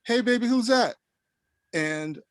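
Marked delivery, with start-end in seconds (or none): 0.0-1.0 high-pitched imitation of a woman; woman calling out. Retelling a woman asking her partner about the visitor, high imitated voice. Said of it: "I hear a woman say".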